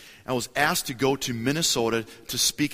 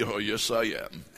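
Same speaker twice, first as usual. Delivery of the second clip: smug mock; 3 semitones lower, slower than usual. Smug reply to friends.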